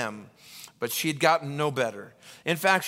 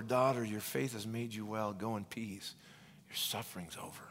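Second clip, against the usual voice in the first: tender blessing; 5 semitones lower, 11 dB quieter than usual. Acted. Jesus speaking to healed woman.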